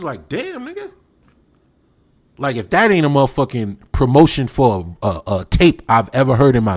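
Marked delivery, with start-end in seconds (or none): 0.3-0.9 high, soft surprised reaction; taken aback, high and hushed; mock surprise. Friends stunned by his outburst, high surprised.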